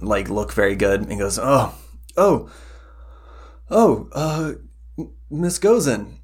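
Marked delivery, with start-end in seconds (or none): 1.4-6.1 startled sleepy priest. Acted. Drowsy priest startled awake.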